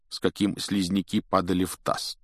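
ordinary delivery, nothing marked

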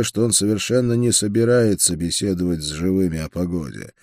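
plain reading voice

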